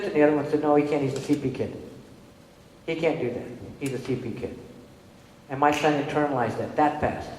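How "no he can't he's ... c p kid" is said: low, soft offhand dismissal; dismissive murmur. Retold or imitated. Adult telling another the boy can't do it, low and soft. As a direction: say it lightly like it's obvious.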